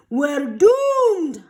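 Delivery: loud, high panicked holler. Acted. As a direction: holler in despair. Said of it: "hollered Chester's dad".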